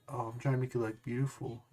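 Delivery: lightly mock a creepy sweet-talker; imitating a creepy tone. Mimicking the friend answering a kid, soft creepy sweetness. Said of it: "your tone sounds like a pedophile tone".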